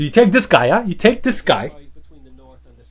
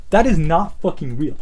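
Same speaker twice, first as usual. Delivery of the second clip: ordinary talk, nothing marked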